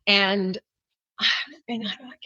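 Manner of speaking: ordinary talk, nothing marked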